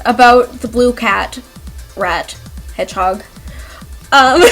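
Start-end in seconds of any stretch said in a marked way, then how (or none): none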